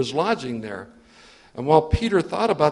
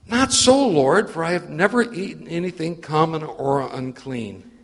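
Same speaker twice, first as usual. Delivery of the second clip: alarmed loud protest; 3 dB louder than usual. Acted. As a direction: cry out against the command. Third refusal of the voice.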